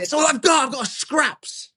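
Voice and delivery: high, strained furious rant; ranting at God. Retold or imitated. To God at dawn while feeding the dog, high furious rant. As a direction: vent the anger at God, voice up high. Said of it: "And I'm like".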